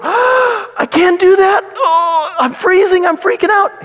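high, loud mock panic, near crying; panicked imitation. Preacher imitating a scared beach evangelist, panicking. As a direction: squeal it like a terrified kid. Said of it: "would make anyone go".

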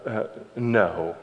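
ordinary delivery

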